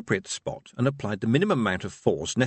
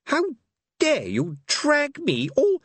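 indignant minstrel; 3 dB louder, slower than usual. A narrator's reading.

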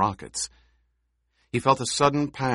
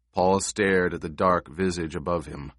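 usual speaking voice

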